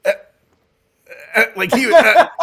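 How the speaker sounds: high, loud imitation of a delighted groan; imitating pleased groan. Pastor husband hearing my lord, a mock delighted groan. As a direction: mimic the husband melting with pleasure. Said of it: "he would go".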